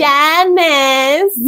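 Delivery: put-on nasal Janice from Friends, New York accent; mock New York accent name call. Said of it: "this terrible New York accent".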